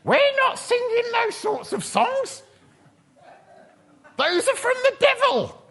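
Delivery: very high, squawking mock outrage; hammed-up falsetto indignation; outraged churchgoer. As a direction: screech it like a scandalised parishioner. Acting out outraged church members' objections.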